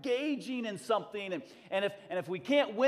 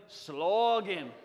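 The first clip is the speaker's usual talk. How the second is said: "Slogan" with extended Scottish O, louder; 5 dB louder than usual. Shown as a demonstration. Leading the church in saying "Slogan", long Scottish O. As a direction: draw out the O in "Slogan" like a Scot. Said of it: "All the O's are extended".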